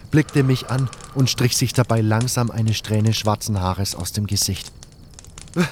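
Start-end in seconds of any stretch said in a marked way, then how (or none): 5.5-5.6 high, stammering cry of disbelief; stunned joy. Acted. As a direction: gasp out her name in amazement. Narrator sees lost love. (stockender, hoher Ausruf der Überraschung)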